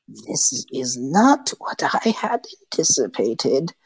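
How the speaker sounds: slow unvoiced hissing hag whisper. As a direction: creep the words out like a cornered witch.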